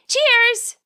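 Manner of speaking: high, loud, bright cheer; cheerful toast. A narrator's reading. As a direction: raise a toast with gusto. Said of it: "Nimona says and lifts the plate".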